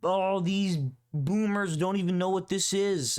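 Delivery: mock his smugness, pitch up; mocking smug guy. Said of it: "being like".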